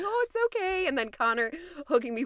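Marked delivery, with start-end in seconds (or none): 0.0-0.9 higher, lightly voiced comforting friend; imitating soothing friend. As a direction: coo it like a friend mid-hug. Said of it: "Nicole hugging me, going".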